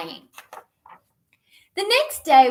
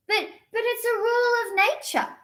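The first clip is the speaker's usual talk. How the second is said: kitten voice; 11 semitones higher, faster than usual. Acted. Kitten insists.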